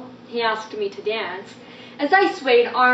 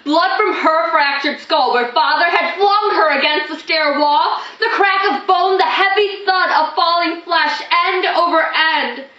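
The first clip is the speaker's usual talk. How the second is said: high, loud, driving outburst; harrowed, impassioned recital; anguished horror; 7 semitones higher, 7 dB louder than usual. Acted. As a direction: build the violent memory in raised, distraught tones. Horror of mother's violent death.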